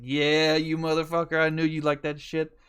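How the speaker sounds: loud, gleeful gotcha; triumphant retold call-out. Driver catches manager liking the song, loud mock-accusing crow. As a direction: crow it like you just caught him. Crowing at manager caught singing along.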